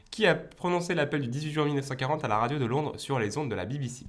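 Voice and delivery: normal talking voice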